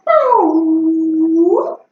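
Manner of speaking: high, loud spooky boo; jump-scare boo. Acted. Arcade machine spooky voice.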